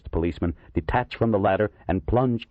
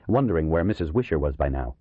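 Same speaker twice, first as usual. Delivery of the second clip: plain reading voice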